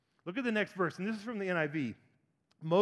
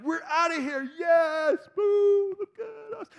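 much higher, cocky, hammed-up strut; mock bold swagger; 10 semitones higher, 7 dB louder, slower than usual. Israelites gloating as they leave Egypt, hammed-up swagger. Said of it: "they were marching boldly".

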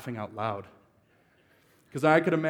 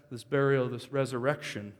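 ordinary talk

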